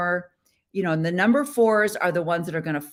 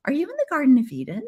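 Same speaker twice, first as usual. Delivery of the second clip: quick, high mock viewer question; 8 semitones higher, much faster than usual. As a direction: tease like a puzzled viewer. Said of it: "you're like".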